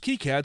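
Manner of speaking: KiCad said as KEE-cad; "kee" pronunciation. Shown as a demonstration. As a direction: say the "ki" in KiCad like key. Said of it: "he's heard it pronounced".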